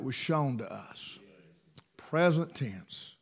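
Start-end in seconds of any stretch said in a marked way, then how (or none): 2.0-3.1 "Present tense" repeated softer and lower, slow; quiet echo. Shown as a demonstration. Preacher repeating the phrase to the congregation, slow and soft.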